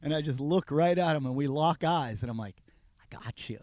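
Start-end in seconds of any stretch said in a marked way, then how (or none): 3.0-3.6 hushed, voiceless retold whisper; whispered gotcha. Storyteller locking eyes with the driver, whispered. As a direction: whisper it like a hunter who found his man.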